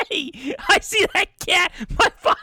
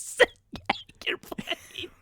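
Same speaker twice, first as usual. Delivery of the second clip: bitter whisper; 8 dB quieter than usual. Acted. Mom whispering about the sick cat game. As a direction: whisper it, slow and cutting.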